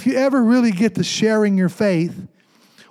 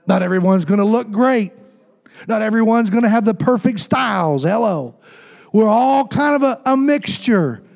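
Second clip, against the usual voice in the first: ordinary talk, nothing marked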